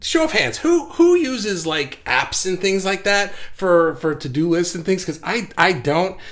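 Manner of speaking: normal talking voice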